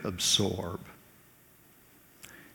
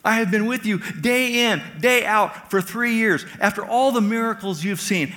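much higher and louder, frustrated and incredulous; Jesus exasperated with Philip, a raised voice; 10 semitones higher, 4 dB louder than usual. Retold or imitated. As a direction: throw up your hands at him. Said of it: "Jesus says".

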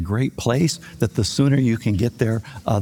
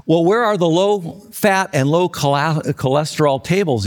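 ordinary talk, nothing marked

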